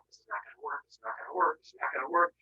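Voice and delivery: low, quiet repeated aside; muttering to oneself. Acted. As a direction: mutter it under your breath, low. Nervous aside before tricking Cronus.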